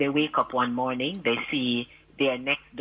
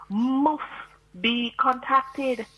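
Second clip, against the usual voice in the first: MUST set apart, high and drawn out; slow, raised "must be contacted", leaning on MUST; stress on MUST; 9 semitones higher, much slower than usual. Real, not acted. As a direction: hit MUST hard and hold it. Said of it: "you stress the word".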